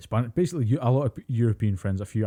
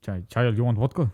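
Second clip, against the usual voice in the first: questioning mock Russian accent; 3 dB louder than usual. Playing a Russian offering child vodka, mock Russian accent. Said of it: "was a Russian accent".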